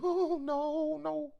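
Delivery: squeaky high falsetto whine; mock timid worker. Organizer mocking a worker dodging the petition, high squeaky voice. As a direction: shrink back in a high, scared squeak.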